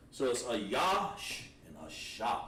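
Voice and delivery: Ayash and Asha said slowly, side by side; slow contrast. Shown as a demonstration. Teacher contrasting two similar words. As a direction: set Ayash against Asha with care. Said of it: "Little difference".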